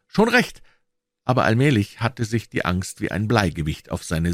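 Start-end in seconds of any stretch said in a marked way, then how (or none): 0.1-0.6 louder, higher mock-sure "Wohl"; hollow boy's reassurance; forced reassurance. A narrator's reading. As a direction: pretend all is fine. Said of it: "sagte er immer noch".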